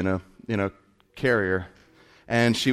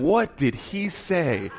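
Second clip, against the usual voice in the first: slow, raised mock bewilderment; wondering onlookers; 4 semitones higher, much slower than usual. Restaurant onlookers wondering about the kid, mock amazement. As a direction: stretch it out in puzzled wonder. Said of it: "everybody in the restaurant was probably wondering".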